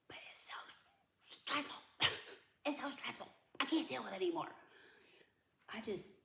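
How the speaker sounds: strained half-whisper breaking high; real overwhelm, squeezed out softly; stressed out. Real, not acted. Venting about dad's calls, overwhelmed and stressed. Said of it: "all that is so stressful for me".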